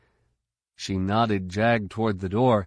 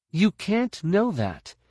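higher, slow objecting woman; young woman's voice; 10 semitones higher, slower than usual. A narrator's reading. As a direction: push back, measured and firm.

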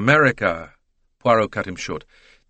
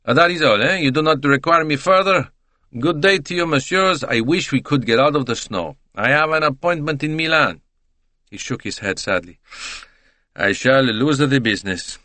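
put-on higher, lively Italian-accented voice, gossipy about the English; chatty Italian witness; 5 semitones higher than usual. Talkative Italian tells the detective his night, higher and lively. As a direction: play the talkative Italian salesman.

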